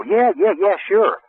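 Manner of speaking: lightly voiced relieved customers; agreeable prospect. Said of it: "And they'd go".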